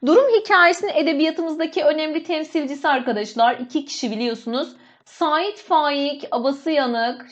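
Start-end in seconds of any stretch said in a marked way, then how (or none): 5.0-7.3 each name drawn out slowly; slow dictation. Shown as a demonstration. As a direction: spell the name out slowly for students to copy. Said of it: "Şöyle".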